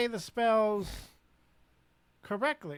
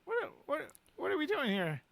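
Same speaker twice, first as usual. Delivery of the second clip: bewildered; 9 semitones higher, 5 dB quieter than usual. Retold or imitated. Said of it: "I'm like".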